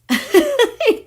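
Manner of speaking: high-pitched burst of giggling; real high, gleeful laughter. Real, not acted. Gleeful reaction to her own joke.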